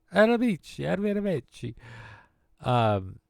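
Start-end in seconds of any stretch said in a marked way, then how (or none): 0.1-1.7 high, put-on voice of a dad; mock foreign farewell, sung out high; dad's farewell. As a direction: imitate dad's cheery goodbye, pitched way up. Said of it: "my dad, what he said".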